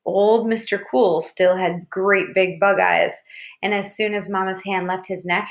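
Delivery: usual speaking voice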